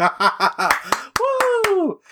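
high whooping cheer; excited whoop. Acted. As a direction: cheer as you run off stage. Cheering off stage exit.

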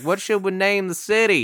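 a folksy Texan settler pondering; Texas accent. Retold or imitated. Town founder naming the city, Texas accent. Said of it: "Is that an offensive accent?".

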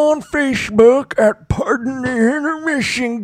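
put-on high old-timer voice; old guy voice. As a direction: read the handle like grandpa. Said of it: "Not in my old guy voice".

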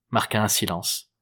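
ordinary delivery, nothing marked

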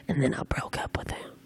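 hushed, almost voiceless confession; whispered aside. Real, not acted. Host confiding about a secret relationship's end, whispered aside. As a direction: drop to a whisper for the punchline.

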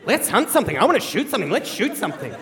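hammed-up swooping, gleeful relish; power-drunk. Son holding a gun feels power, mock-crazed relish. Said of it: "I get this weird sense of power".

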